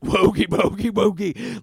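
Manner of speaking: loud, high mock metal chant; fantastical metal vocals. Mocking fantastical metal bands, loud put-on chant. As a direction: belt out nonsense like a metal singer. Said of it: "those fantastical metal bands".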